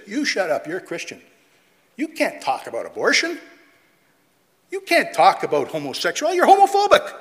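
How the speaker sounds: rising to a loud indignant shout; mock angry retort. Preacher mimicking an accuser, building to a shout. Mocking pink shirt person's retort.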